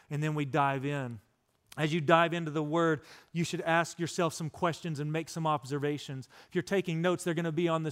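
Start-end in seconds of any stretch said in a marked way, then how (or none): none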